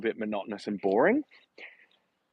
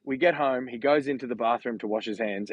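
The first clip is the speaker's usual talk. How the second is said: ordinary talk, nothing marked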